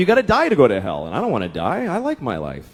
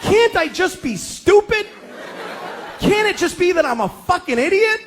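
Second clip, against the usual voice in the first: pitched-up exasperated plea to his wife; 5 semitones higher than usual. Retold or imitated. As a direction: beg her to let you be dumb. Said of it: "And I'm like".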